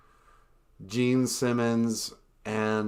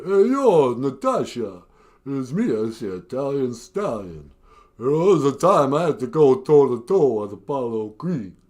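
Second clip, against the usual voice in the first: lopsided slurred Italian Stallion voice; Stallone impression; 7 dB louder than usual. Shown as a demonstration. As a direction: talk out of one side of the mouth like Rocky. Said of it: "impersonation of Sylvester Stallone".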